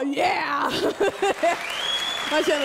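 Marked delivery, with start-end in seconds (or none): 0.0-0.6 mock cheering yell. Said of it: "Sitter några svarta män och bara". 0.7-2.3 whooping laugh cheer. Retold or imitated. Men in audience cheering.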